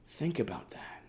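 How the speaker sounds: hushed, conspiratorial whisper; breathy dramatic hush. Acted. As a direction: draw them in with a secret whisper. Dramatic aside to the reader.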